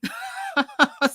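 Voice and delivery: sudden high squealing laugh; high burst of laughter. Real, not acted. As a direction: squeal with laughter at your own joke. Laughing at needing therapy.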